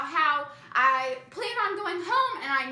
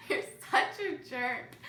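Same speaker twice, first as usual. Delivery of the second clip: laughing, slow teasing insult; 10 semitones higher, 3 dB quieter, slower than usual. Real, not acted. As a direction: laugh it off at him. Laughing at boyfriend's jab.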